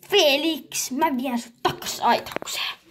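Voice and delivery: usual speaking voice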